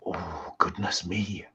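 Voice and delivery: breathy scandalised whisper; shocked whisper. Acted. Princess reacts, shocked.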